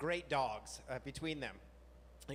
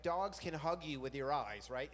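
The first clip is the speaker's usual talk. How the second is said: ordinary delivery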